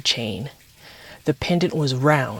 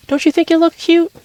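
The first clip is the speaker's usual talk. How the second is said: pleading; 7 dB louder than usual. Acted. Teen pleading with her father.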